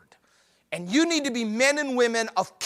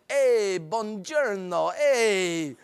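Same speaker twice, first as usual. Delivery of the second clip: mock Italian; slower than usual. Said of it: "It's like speaking, uh, Italian".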